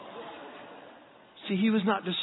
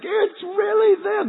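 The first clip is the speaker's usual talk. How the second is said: put-on high, stretched-out kid squeal; mock excited child; 12 semitones higher, 4 dB louder, slower than usual. Boys seeing Chip and Dale, hammed-up excitement. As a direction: imitate a little boy overcome with thrill.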